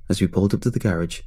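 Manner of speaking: plain reading voice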